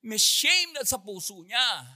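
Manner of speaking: ordinary talk, nothing marked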